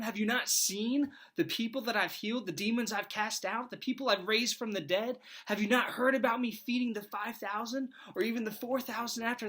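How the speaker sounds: incredulous Jesus. Pretend. Jesus answering the doubting father, high incredulous disbelief. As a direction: play Jesus baffled that anyone doubts him. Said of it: "if I'm Jesus, I'm probably thinking".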